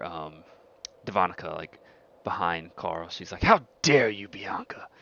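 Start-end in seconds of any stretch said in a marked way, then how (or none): 3.4-4.9 louder, higher burst of acted outrage; affronted pack member lashing out; indignant outburst. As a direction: flare up at a challenger. Devonica confronts Bianca in acted quarrel.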